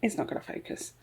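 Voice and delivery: whispered, giving up; resigned whisper at the camera. Real, not acted. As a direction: breathe it out in defeat.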